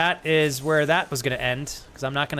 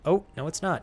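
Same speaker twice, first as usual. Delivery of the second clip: ordinary delivery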